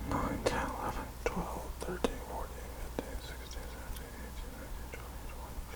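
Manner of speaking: fading from soft voice to whisper; whispered counting. Real, not acted. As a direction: count under your breath.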